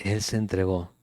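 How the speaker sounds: soft, low él se entregó; hushed él se entregó; quiet. Shown as a demonstration. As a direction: let él se entregó sink in softly. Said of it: "¿Sí?".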